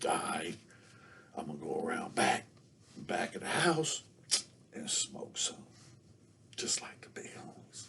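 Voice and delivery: low, hushed whisper; sneaky whisper. Retold or imitated. Kid sneaking behind the house, whispering. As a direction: keep it secret, barely breathing.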